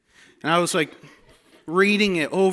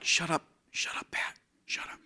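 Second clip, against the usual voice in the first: hissed unvoiced shut up, much softer; urgent whisper; 8 dB quieter than usual. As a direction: whisper sharply to hush him. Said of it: "And I'm like".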